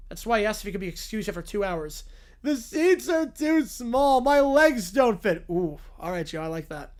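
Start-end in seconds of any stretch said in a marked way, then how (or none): 2.4-5.4 whiny cartoon bear. The bear complains about the seats.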